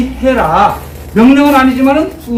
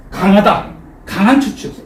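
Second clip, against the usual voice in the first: usual speaking voice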